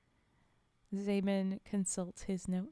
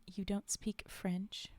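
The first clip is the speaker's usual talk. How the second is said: slow, whispered, looking down on him; condescending; 4 dB quieter, slower than usual. Acted. Creature sneering at the specialist, condescending whisper. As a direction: drip with condescension, slowly. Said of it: "a heavy dose of condescension".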